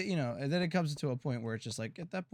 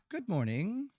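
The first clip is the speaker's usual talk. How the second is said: higher, dipping then lifting at the end; sing-song greeting; 7 semitones higher, slower than usual. Pretend. Streamer greeting a viewer, high sing-song. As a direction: chirp it with a rising lilt.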